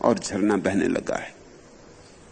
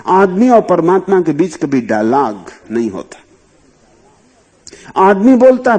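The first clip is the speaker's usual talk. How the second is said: raised, louder preaching voice; impassioned sermon; fervent preaching; 7 semitones higher, 8 dB louder than usual. Acted. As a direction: proclaim it to the listeners. Emphatic preaching on silence.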